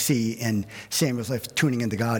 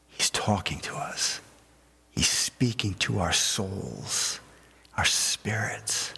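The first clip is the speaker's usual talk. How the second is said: hushed; 5 semitones lower, slower than usual. Acted. Illustrating God's still small voice.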